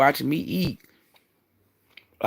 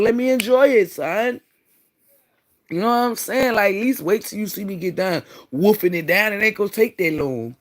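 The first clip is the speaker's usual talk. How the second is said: exasperated plea; 8 semitones higher, 4 dB louder than usual. Real, not acted. Pleading high.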